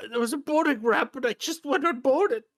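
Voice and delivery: high, loud whiny plea; pleading crippled man. Acted. Crippled man accusing Lorne of broken promises, high pleading. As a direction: beg him in a high, strained voice.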